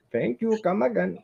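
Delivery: high, laughing Apu impression; chirpy shopkeeper catchphrase voice. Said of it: "sounding like a poo from The Simpsons".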